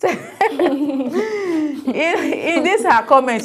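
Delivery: laughing through the words, slowed down; can't hold back a laugh at the comment. Real, not acted. Host laughing at a listener's comment, slowed laughing.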